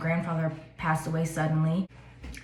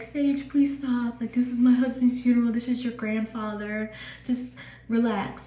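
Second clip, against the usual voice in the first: imitating crying grandma; 5 semitones higher than usual. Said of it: "she was crying and she was like".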